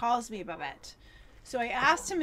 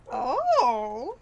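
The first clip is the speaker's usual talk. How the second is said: imitated loud, impressed "oh"; impressed reaction; 6 dB louder than usual. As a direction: mimic someone pleasantly taken aback. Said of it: "He was like".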